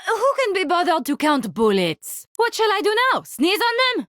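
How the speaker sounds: ordinary delivery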